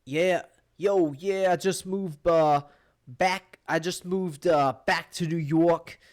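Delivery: imitating his thick New York accent, stretched out; mock New York accent. Retelling a guy who just moved to New York, exaggerated New York accent. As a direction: put on a heavy New York drawl. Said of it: "you're fucking talking like that".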